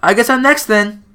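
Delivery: put-on high, bouncy young girl; chirpy and eager; bubbly girl voice. Sayori voiced.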